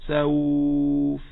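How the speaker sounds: سوف stretched very long on the waw. Shown as a demonstration. Teacher shows the lengthened سوف. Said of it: "لا ينقطع الصوت".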